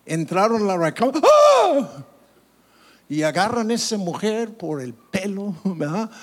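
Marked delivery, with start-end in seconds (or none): 1.2-2.0 loud, very high "Ah!"; hammed-up high gasp of shock; acted shocked cry. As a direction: cry out as if walking in on a scandal. Acts out shock at bursting in.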